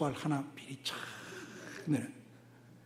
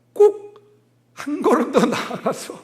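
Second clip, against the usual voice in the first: emphatic jump; 8 semitones higher, 9 dB louder than usual. Retold or imitated. Mocking the person who says more.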